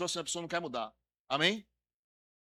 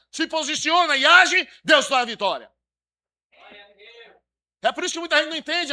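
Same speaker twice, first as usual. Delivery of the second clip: loud, driving sermon delivery; full-force preaching; 3 semitones higher, 9 dB louder than usual. Acted. Pastor urges congregation to fight, loud preaching. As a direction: preach it like a battle cry.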